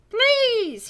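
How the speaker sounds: put-on boy begging the teacher. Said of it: "he begs".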